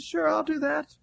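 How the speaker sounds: high, light imitated yes; good-natured agreement. Preacher voices Ira agreeing to meet Moody, good-natured. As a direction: agree easily, pitched well up. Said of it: "Ira was good-natured".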